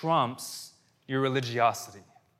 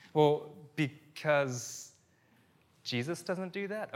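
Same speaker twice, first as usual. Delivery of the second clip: put-on unsure child answering; 3 semitones higher, slower than usual. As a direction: imitate a kid guessing tentatively at the answer. Voicing daughter groping for an answer.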